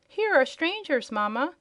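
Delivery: put-on high, shrill child calling to mother; shrill little kid's cry; shrill childish voice. A narrator's reading. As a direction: pipe it out like an excited little child. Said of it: "cried the shrill and childish voice".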